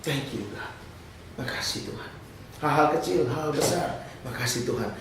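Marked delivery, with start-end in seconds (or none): 0.1-2.1 soft, breathy prayer of gratitude; hushed thanks. Shown as a demonstration. Mencontohkan ucapan syukur kepada Tuhan. 4.2-4.9 soft, lowered prayer of gratitude; quiet thanks. Shown as a demonstration. Mencontohkan ucapan syukur kepada Tuhan.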